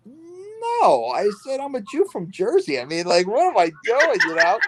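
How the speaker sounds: high, amazed mock protest; a replayed, exaggerated disbelief in a high voice; incredulous denial. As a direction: act stunned that anyone could ask. Retelling his reply to hitman question.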